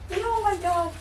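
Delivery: high, amazed oh my God; disbelief. Real, not acted. Mother reacting to kids' stair game, high disbelief. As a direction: gasp it out in disbelief. Kids bowling each other down.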